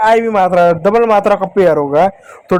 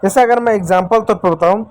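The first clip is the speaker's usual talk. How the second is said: ordinary delivery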